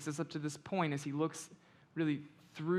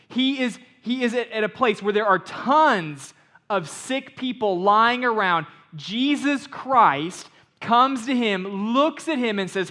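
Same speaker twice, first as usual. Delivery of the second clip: loud, higher, driving sermon voice; preaching at full force; 5 semitones higher, 12 dB louder than usual. Acted. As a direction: hammer the point home to the congregation.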